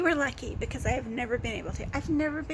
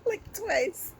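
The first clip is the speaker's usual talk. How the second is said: laughing; 5 semitones higher than usual. Real, not acted. Laughing through words.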